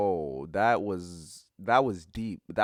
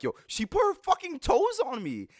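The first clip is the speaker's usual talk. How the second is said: high, indignant retold cry to the table; outraged complaint. Telling everyone at the table what she did, a high outraged cry. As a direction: squeal it out, appalled and disbelieving. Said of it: "I'm like".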